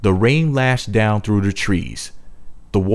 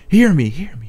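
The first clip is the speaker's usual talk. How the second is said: calling out; 6 semitones higher than usual. A narrator's reading. Young woman calling to the witches, raised call. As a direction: call out to the cave, pleading. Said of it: "Kiko cried".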